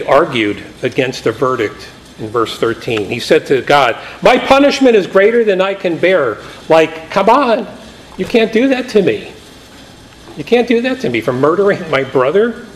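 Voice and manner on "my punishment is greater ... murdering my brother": high, pleading complaint; whiny protest. Acted. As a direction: protest like a sulky man caught out. Cain protesting, voiced.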